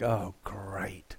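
whispered, deflated sarcasm; seeing the wrecked bike walked home, sarcastic whisper. Retold or imitated. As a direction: breathe it out in a low whisper, fed up. Sees the bike walked back.

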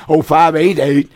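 usual speaking voice

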